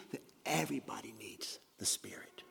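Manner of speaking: sudden hushed whisper after the shouting; whispered emphasis. Real, not acted. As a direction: lean in and whisper the point. Preacher drops to a hush after climax.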